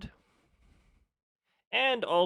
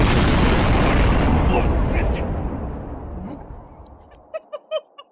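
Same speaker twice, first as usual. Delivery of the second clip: loud breathy laugh; 11 semitones higher, 11 dB louder than usual. Real, not acted. Laughing after being scared.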